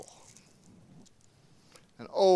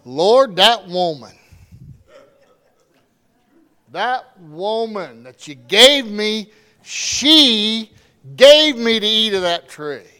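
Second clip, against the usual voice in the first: loud, accusing plea, slow in the middle; blaming plea; 4 semitones higher, 12 dB louder, slower than usual. Acted. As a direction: point the finger at the woman. Adam blames Eve before God.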